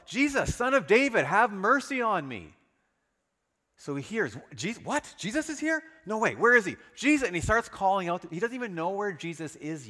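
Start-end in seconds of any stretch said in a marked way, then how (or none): 0.1-2.5 loud, high cry for mercy; calling out. Acted. Blind beggar cries out to Jesus over the crowd, loud and pleading. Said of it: "he called out". 4.5-7.3 high, loud, breathless excitement; can't believe it, shout it out; excited disbelief. Acted. Blind beggar hears Jesus is passing, shouting in excited disbelief.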